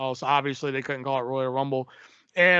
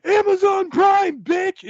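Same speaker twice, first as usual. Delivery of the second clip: high, drawn-out, louder mock scream; imitated battle cry, pitched way up; retold scream; 14 semitones higher, 7 dB louder, slower than usual. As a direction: yell it like a wrestler's triumphant taunt. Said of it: "and screams".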